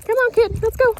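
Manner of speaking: high, loud urging call; calling the dog. Real, not acted. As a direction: hurry the dog along brightly and loudly. Calling the dog along while training.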